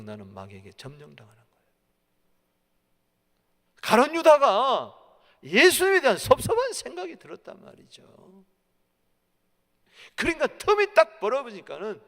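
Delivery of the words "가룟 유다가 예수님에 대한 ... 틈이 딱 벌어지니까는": high, loud pulpit storytelling; full-force preaching. Real, not acted. Preacher recounts Judas opening to temptation, full-force preaching. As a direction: drive the story forward with a shouted voice.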